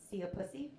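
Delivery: "See a pussy" said like Hungarian "szia puszi"; Hungarian pronunciation. Shown as a demonstration. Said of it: "is pronounced".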